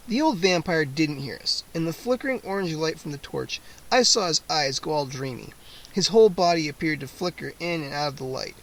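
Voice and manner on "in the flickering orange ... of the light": ordinary delivery, nothing marked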